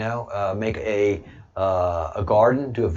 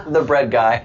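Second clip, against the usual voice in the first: laughing; 3 semitones higher, 6 dB louder than usual. Real, not acted. Laughing repeat of nickname.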